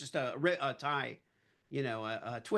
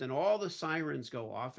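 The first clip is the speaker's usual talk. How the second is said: ordinary talk, nothing marked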